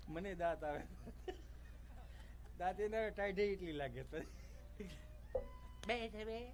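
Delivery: soft, slow absent-minded; distracted mumble. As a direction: mumble while jiggling the baby. Dad mumbles bouncing crying kid. (છોકરું હીંચોળતા બાપનો ધીમો ગણગણાટ)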